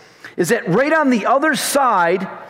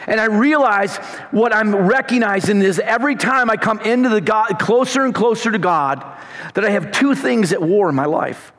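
ordinary talk

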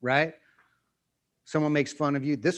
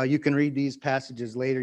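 normal talking voice

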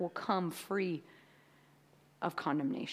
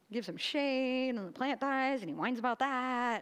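ordinary talk, nothing marked

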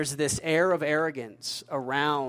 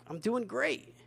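mock-proud, much higher voice; smug boast; 8 semitones higher, 4 dB quieter than usual. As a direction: brag smugly about status. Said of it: "We have social pride".

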